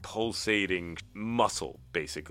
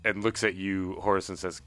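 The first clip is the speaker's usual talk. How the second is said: ordinary talk, nothing marked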